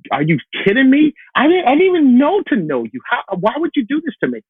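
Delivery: high, loud, pleading disbelief; betrayed and heartbroken. Retold or imitated. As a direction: cry out as if betrayed by God. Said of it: "this complete, like, just".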